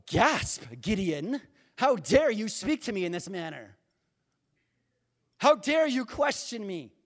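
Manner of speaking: hammed-up outrage, loud; mock-offended God. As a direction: act scandalized at being questioned. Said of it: "God doesn't go".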